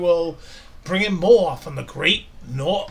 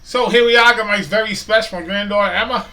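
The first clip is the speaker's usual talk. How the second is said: higher, louder, beaming welcome; excited greeting; 6 semitones higher, 9 dB louder, faster than usual. Real, not acted. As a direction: burst in cheerfully. Cheerful greeting, introducing his granddaughter.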